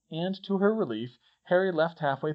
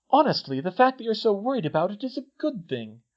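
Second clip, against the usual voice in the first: higher-pitched, earnest persuasion; insistent; 8 semitones higher, 4 dB louder than usual. A narrator's reading. Hermione trying to console Harry, insistent. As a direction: press the point with raised pitch. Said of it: "she insisted".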